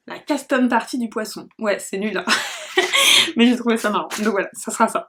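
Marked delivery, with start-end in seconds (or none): 2.3-3.3 short breathy laugh, pitched up; a quick giggle at her own joke; amused laugh. Real, not acted. Laughing at her own silly party name, breathy giggle.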